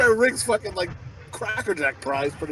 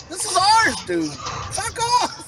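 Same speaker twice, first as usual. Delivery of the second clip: high-pitched mock outrage; retold squabble, voice jumping up; indignant retort; 14 semitones higher, slower than usual. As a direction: protest shrilly, hammed up for laughs. Acts quarrel with bossy son.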